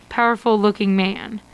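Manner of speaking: usual speaking voice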